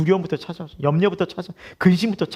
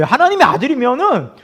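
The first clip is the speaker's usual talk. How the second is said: ordinary delivery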